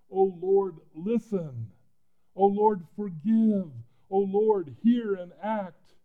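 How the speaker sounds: shouting to God, high and slow, pleading; passionate cry. Shown as a demonstration. Preacher crying out to God as Daniel. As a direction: cry it out with all the passion you have. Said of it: "imagine saying this with all the passion you can say it with".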